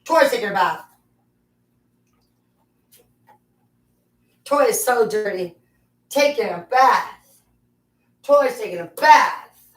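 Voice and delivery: bath-time chant for the dog; pet voice. Real, not acted. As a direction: coo and sing it to a dirty pup.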